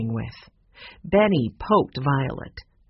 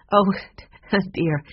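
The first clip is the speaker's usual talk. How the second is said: nervous laugh; 4 semitones higher than usual. Acted. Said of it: "she laughed nervously".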